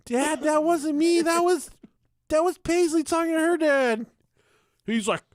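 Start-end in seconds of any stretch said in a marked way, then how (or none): 0.0-4.0 put-on high, loud child voice; high girl's voice correcting dad; little girl voice. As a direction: speak up high like a small girl. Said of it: "little girl was like".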